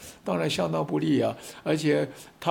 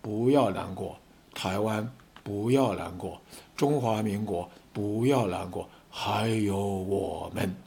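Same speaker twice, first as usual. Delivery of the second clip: softer, low and tender, slowing at the end; soft heartfelt reassurance; 6 semitones lower, 5 dB quieter, slower than usual. Acted. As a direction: comfort the whole nation quietly. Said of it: "都说". (温柔低声地安慰)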